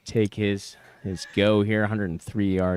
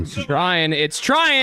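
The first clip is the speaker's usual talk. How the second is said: rising live call, louder each time; excited; 8 semitones higher, 9 dB louder than usual. Real, not acted. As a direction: urge the ball on.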